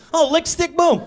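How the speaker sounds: high, slick salesman demo; carnival barker. Retold or imitated. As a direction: pitch it like a carny. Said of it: "That's what he did to me".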